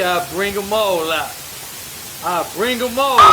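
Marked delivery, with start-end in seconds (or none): 2.2-3.3 louder, rising challenge to doubters; emphatic repeat. Real, not acted. Vlogger daring doubting viewers, louder rising challenge. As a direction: throw it at the viewers. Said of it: "Y'all don't believe me".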